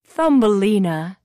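loud call of a name; bird calling out. A narrator's reading. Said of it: "says the bird".

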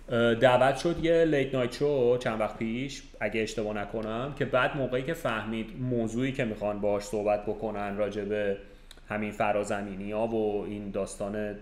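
ordinary talk, nothing marked

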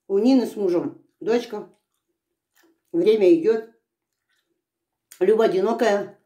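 chewing while speaking; talking between bites; mouth full of melon. Real, not acted. Seen 2: Holds melon slice at mouth; cheeks bulge, chewing between phrases.

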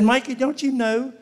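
high loud comic exasperation; mock shout. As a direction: yell it in comic disbelief. Shouting at Mikey in story.

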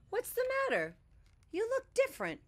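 very high little rabbit voice. A narrator's reading. Rabbit asks Bear what is wrong, in a high voice. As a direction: squeak it like a small caring rabbit. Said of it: "said Rabbit".

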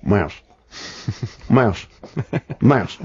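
Mouse said low with a bounce, [name] style. Shown as a demonstration. Mouse drilled for the [name] impersonator. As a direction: drawl Mouse with a Memphis bounce. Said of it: "You put a slight bounce in it".

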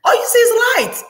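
high, loud, voice cracking with emotion; overcome with excitement. Real, not acted. Reader overwhelmed by the vision, high and loud with a crying edge. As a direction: let it burst out, near tears. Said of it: "I'm getting too excited".

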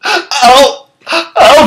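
high gasped oh; high mock uh-oh; mock alarm.

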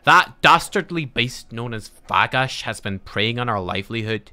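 dwarf quest-giver voice. Acted. Dwarf rancher asks a traveller to slay the beast, dramatic accented voice. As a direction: read it as a dwarf pleading for a hero. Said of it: "I'll do a Russian accent for the next dwarf we talk to".

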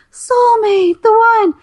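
sarcastic lovesick sigh; mock dreamy. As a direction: gush it like a starry-eyed romantic. Mocking the soulmate ideal.

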